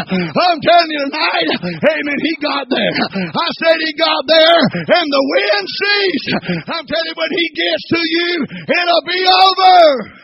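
loud, high climactic shout; preaching at full force. Acted. Preacher shouting that the storm ends, climactic cry. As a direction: land it like the final blow.

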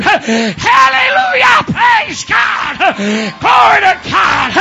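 very high, loud, drawn-out cries; ecstatic praise shouting. Retold or imitated. Slave jumping up in the balcony, ecstatic shouting. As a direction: erupt in praise at full voice.

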